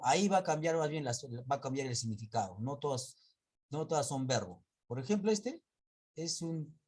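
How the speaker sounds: ordinary delivery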